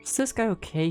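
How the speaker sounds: normal talking voice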